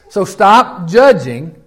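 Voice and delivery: each word laid down slowly and firmly; slow emphatic command. Real, not acted. Preacher pressing the congregation, slow and firm. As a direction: press "So stop judging" home, word by word. Preacher hammering his point.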